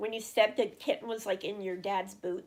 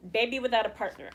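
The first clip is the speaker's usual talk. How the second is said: normal talking voice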